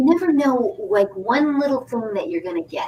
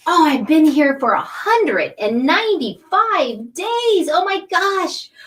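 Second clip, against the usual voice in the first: higher, drawn-out amazement; amazed exclamation; 6 semitones higher, slower than usual. Real, not acted. Host marvelling at her long run of shows, amazed. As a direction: marvel slowly, stretching the big words.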